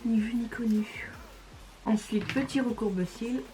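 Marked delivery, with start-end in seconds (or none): 0.0-1.1 playful whisper; conspiratorial whispered aside. Real, not acted. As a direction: whisper it like a little secret. Conspiratorial aside after hiding a smudge.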